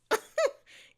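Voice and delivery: very high, loud giggling shriek; squealing laugh. Real, not acted. Giddy laugh after my boo.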